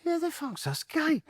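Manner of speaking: high, slow squeaky mock voice; mocking journalists. Mimicking the journalists puzzled by the goalkeeper, high and slow. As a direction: mimic the baffled hacks.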